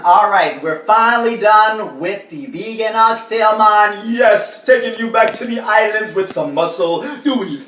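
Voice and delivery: high, loud party-host voice; hyped chef persona. Acted.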